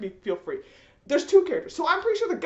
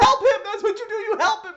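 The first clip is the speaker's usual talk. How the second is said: higher, louder exasperated order with a laugh; exasperated yelling; 10 semitones higher, 4 dB louder than usual. Retold or imitated. Viewer yells at the show's characters, exasperated and laughing. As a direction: bark it at the screen, half laughing. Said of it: "I'm like".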